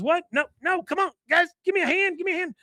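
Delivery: way up high, calling for a hand; high panicked plea. Retold or imitated. Rich calling to the guys while Hank holds him, high panicked squeal. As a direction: squeal for help, high and urgent.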